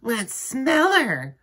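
high, slow mocking kid; teasing child. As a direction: drag it out to tease her. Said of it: "said Joe".

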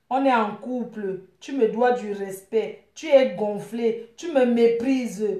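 measured, solemn-sounding lover's complaint; reproachful love message. Pretend.

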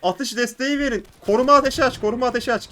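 urgent loud calls for cover fire; battle cry for covering fire; shouted orders. Real, not acted. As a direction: yell it to your squad.

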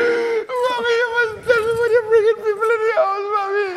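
high, crying protest; crying plea. Acted. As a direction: sob it out at her. Son crying to mother.

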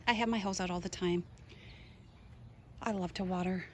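ordinary talk, nothing marked

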